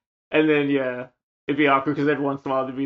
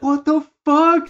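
much higher, slow incredulous outburst; outraged disbelief; 12 semitones higher, 6 dB louder, slower than usual. Retold or imitated. Friends reacting to the stereo swap, incredulous. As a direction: stretch it out in disbelief. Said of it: "it was like".

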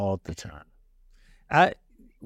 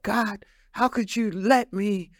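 retold upset plea, pitched up; 4 semitones higher than usual. Speaker retelling upset words to God, upset. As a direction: imitate your own upset question to God. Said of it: "I, I was upset".

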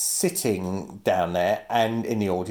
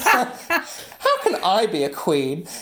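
laughing mock plea; 7 semitones higher, much slower than usual. Imitating characters, laughing.

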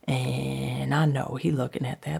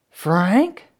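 louder questioning call, rising; raised voice, lifting at the end; 5 semitones higher, 8 dB louder than usual. Acted. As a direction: call through the door for an answer. Calls again through the door.

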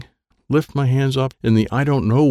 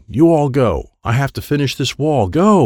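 raised, higher-pitched command; insistent yell; 5 semitones higher, 3 dB louder than usual. A narrator's reading. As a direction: send them away with a moderate yell. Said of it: "Wyatt yelled".

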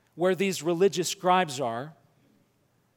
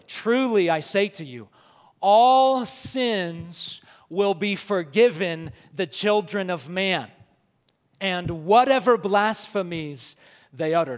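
solemn, official decree with authority; formal proclamation; 4 dB louder than usual. Shown as a demonstration. Jesus handing down his formal opinion to the scribes, solemn and official. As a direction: pronounce it like an official ruling, slow and weighty. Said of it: "He talks like this to convey authority".

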